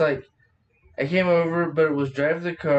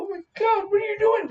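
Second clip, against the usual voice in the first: shocked shout; faster than usual. Pretend. Shocked outburst.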